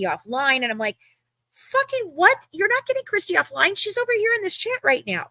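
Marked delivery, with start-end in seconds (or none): 1.5-5.3 very high-pitched indignant retort; outraged disbelief. Retold or imitated. Retelling argument with Jasmine.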